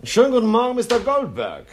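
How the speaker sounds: high, loud welcome; hearty greeting. Acted. Gang boss to arriving diamond buyer, hearty loud welcome. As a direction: call out an effusive good morning.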